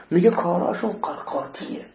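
ordinary talk

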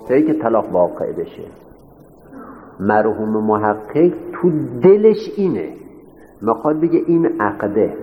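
normal talking voice

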